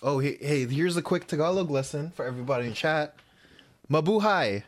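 usual speaking voice

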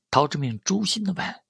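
plain reading voice

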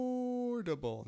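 affordable squeaked out very high and slow; squeaky high. Shown as a demonstration. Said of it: "That's how affordable it is".

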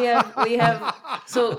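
loud laughing protest; laughing loud over the others. Real, not acted.

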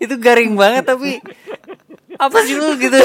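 shouting in mock outrage; high, loud what-was-that protest; loud exasperated outburst. Real, not acted. Reacting to groan-worthy joke.

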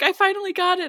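high-pitched imitation of a thrilled hopeful; mock excited contestant. Imitating a singer who finally got picked, mock excited. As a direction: squeal it lightly as a proud contestant.

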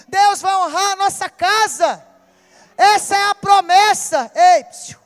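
loud, urgent call to the women; fiery preaching. Acted. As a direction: rouse the church with the promise. (pregação forte e enfática)

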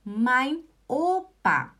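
das ist mein Opa said slowly, every word clear; slow model sentence. Shown as a demonstration. Teacher modelling for students, slow and deliberate. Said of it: "Você pode falar também".

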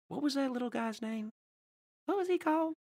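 high, soft presidential impression; George W. Bush. Washington trying to recall a name, soft high Bush voice. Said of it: "is also the same voice as George W. Bush".

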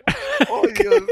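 loud high frustrated wail; exasperated cry. Real, not acted. Frustrated outcry after blanking.

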